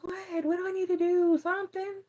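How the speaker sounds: high, puzzled, put-upon whine; high voice of a bewildered homebody; mock dazed Taurus. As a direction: play the lazy sign who can't see why. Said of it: "Taurus is like".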